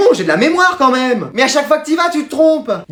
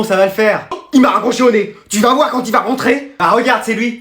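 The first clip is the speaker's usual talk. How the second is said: usual speaking voice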